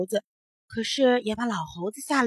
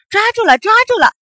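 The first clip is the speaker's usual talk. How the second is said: loud, high excited cry; triumphant shout; 11 semitones higher, 11 dB louder than usual. A narrator's reading. Monkeys catching the running boy, shouting triumphantly.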